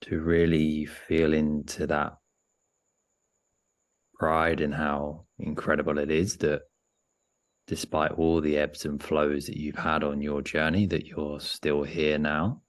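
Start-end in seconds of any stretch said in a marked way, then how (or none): none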